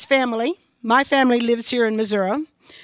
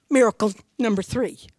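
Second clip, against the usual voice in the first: ordinary talk, nothing marked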